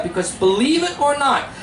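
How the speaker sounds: usual speaking voice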